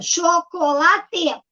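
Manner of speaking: "chocolate" very slowly in four pieces, cho-co-la-te; "chocolate" drawn out, each of its four syllables separate. Shown as a demonstration. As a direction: sound out "chocolate" one syllable at a time. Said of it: "já juntou as sílabas".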